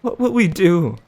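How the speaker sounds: put-on high, loud despairing boy; a frightened son begging for an answer. The boy asks his father in despair, high and loud.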